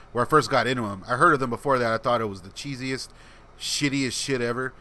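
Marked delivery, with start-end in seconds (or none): none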